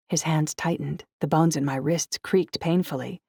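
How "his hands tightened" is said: usual speaking voice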